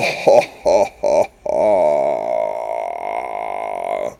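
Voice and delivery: deep rising chuckle; low laugh. Real, not acted. Laugh after "Whoa!.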